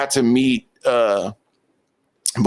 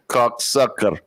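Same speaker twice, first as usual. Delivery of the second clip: slow mimicked curse through laughs; echoed impression; slower than usual. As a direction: echo the curse slowly, amused. Co-host echoes the imitated curse.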